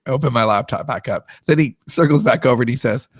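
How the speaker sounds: ordinary delivery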